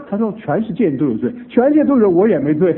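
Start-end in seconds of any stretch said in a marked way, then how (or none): 1.5-2.7 high, loud defiant denial; stubborn retort. Retold or imitated. Mother refusing the pastor's words, defiant shout. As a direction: refuse the charge flatly and loudly. Mother denies any sin.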